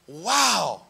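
mock-polite amazement through a smile; forced smile. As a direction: hold back the real reaction, politely. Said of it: "I remember smiling and going".

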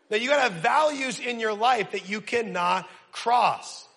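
usual speaking voice

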